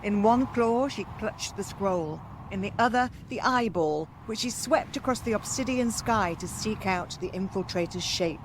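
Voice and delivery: ordinary delivery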